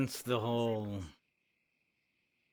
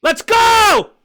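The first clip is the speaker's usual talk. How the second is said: a full-throated, much higher yell into the fight; battle cry; 21 dB louder than usual. Acted. Fighter charging the enemy with a huge yell. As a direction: scream the charge at the top of your lungs.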